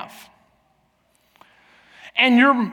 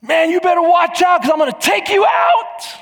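higher, louder shout down the slope; yelled taunt; 8 semitones higher, 4 dB louder than usual. Retold or imitated. Dad zipping after his son on skis, yelled taunt. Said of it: "I yell out this to him".

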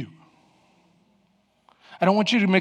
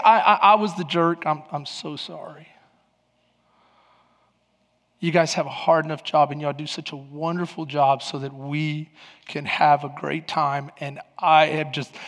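humble apology; 7 semitones lower, 5 dB quieter than usual. Retold or imitated. Apologizing to parking lady.

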